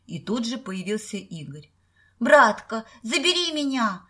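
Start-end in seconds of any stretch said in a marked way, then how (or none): 2.2-4.1 high nasal whine, playing the fool; гундосое нытьё. A narrator's reading. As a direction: whine through the nose to big brother. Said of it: "загундосил Петька". (гундося, плаксиво и громко)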